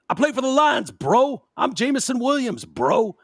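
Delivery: mock the star dropping his own name; a wide receiver name-dropping to a cop; mocking entitled athlete. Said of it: "he goes with".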